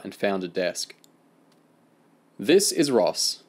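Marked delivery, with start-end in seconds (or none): 2.4-3.4 lightly voiced teacher, slow and raised; measured, higher-pitched classroom introduction; teacher announcing. A narrator's reading. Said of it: "the teacher announced".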